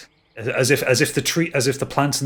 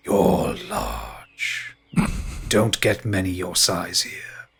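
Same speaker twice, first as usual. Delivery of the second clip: hushed ancient tree voice; 4 semitones lower, much slower than usual. Acted. Tree speaks to giant.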